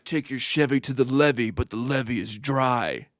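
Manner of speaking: lightly put-on tough-guy catchphrase voice; a hint of a movie star quip; mock Mark Wahlberg line. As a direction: deliver it like a cheesy action-hero one-liner. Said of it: "Be like".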